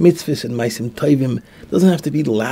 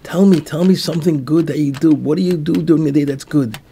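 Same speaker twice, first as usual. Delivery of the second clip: louder, higher pleading demand, acted out; retold yell; 5 semitones higher, 5 dB louder than usual. Rabbi yells at the butcher to name a good deed, desperate retold yell. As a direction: raise your voice as if at your wits' end. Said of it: "and he yells to him".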